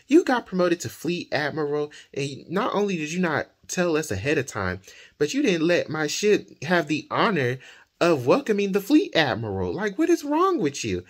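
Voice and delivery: high-pitched retold scolding, rising to an indignant peak; very upset. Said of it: "she gets very upset".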